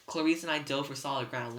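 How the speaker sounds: plain reading voice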